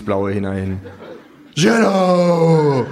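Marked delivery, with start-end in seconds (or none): 1.5-2.9 high kangaroo cry; kangaroo proclaiming. Acted. Kangaroo climbing the counter, high proclaiming cry. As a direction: announce it to the whole bar. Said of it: "ruft das Känguru".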